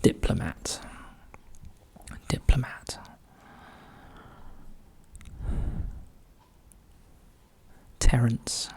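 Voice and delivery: soft whisper while working out the clue; whispering to self. Real, not acted. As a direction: breathe the words as you think.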